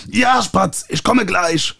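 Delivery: calling out. A narrator's reading. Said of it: "rief ich in Richtung Tür".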